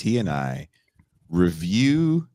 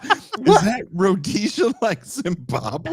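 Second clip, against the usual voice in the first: high, slow puzzled question; imitating Pascal; 14 semitones higher, 4 dB louder, much slower than usual. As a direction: do a naive high voice. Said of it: "Pascal goes".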